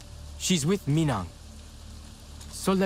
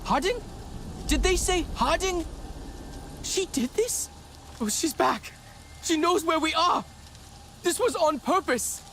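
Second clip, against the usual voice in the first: much higher, stunned and rising; reeling from the news, voice climbing high; learning the enemy caused the crash, shocked; shocked disbelief; 9 semitones higher than usual. Acted.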